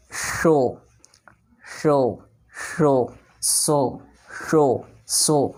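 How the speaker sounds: शो from the palate, सो from the teeth; sh versus s. Shown as a demonstration. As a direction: contrast palate शो with tooth सो. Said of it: "जो दांतों से बोला जाता है".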